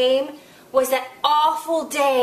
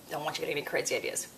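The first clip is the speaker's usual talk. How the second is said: quick aside; 10 semitones lower, 12 dB quieter, much faster than usual. Real, not acted. Fast muttered aside to kid.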